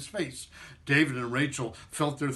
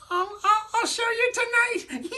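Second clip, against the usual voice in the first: very high, playful squeak; squeaky voice; 4 dB louder than usual. Acted. Matzo man teasing the children, high squeak. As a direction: promise it in a tiny voice. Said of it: "squeaked the matzo man".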